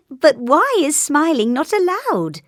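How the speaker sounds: high, loud giggly question; laugh, then ask in amused disbelief; high merry voice after a chuckle. A narrator's reading. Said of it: "laughed little Miss Sunshine".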